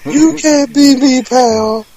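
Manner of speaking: high-pitched wrestler taunt; Ric Flair imitation. Host joining in on the video quotes, Flair imitation. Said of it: "his Flair imitation is amazing".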